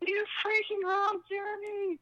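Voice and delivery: high-pitched drawn-out holler across a distance; retold yell. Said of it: "I yell at the top of my lungs".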